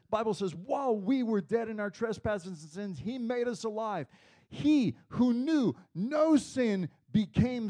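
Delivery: high, loud sermon delivery; forceful, rising pulpit proclamation; impassioned preaching. Real, not acted. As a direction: preach it at full force, driving each phrase home.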